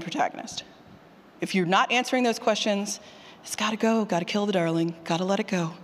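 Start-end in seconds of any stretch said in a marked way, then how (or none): none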